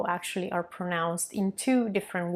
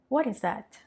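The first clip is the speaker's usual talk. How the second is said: usual speaking voice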